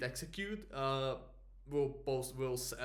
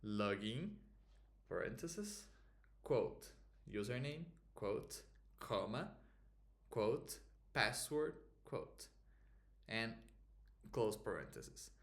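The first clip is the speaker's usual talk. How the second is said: dictating; 3 dB quieter than usual. Shown as a demonstration. Spelling out code syntax slowly.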